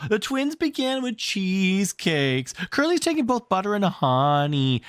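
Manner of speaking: high, whiny tattletale; tattling child. Acted. Children complaining to Wendy, high and whiny. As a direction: tell on everyone like a little child.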